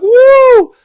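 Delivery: high, loud whooping cheer; crowd cheer. Retold or imitated. Imitating the crowd as helmets come off, loud whoop. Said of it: "everyone's like".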